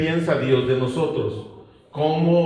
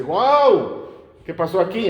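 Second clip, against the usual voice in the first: amazed; 5 semitones higher, 3 dB louder than usual. Acted. King amazed Daniel lives.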